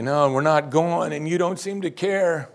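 mock complaint. Preacher imitates doubting disciples to Jesus, mock whining. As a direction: whine it out as a drawn-out grievance.

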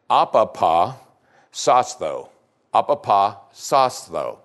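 "Apapa, sostho" sounded out carefully in Greek, said twice; Greek word spoken. Shown as a demonstration. Preacher sounding out the Greek word for deny, carefully. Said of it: "Not easy to say".

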